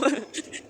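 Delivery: short high-pitched laugh; real giggle. Real, not acted. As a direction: giggle at how weird it is. Laughing at how weird it is.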